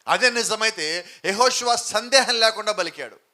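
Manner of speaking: loud, rising sermon delivery; fervent Telugu pulpit preaching; full-force preaching. Acted. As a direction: preach it out to the church, driving each line.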